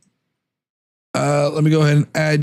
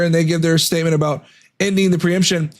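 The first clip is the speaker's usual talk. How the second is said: normal talking voice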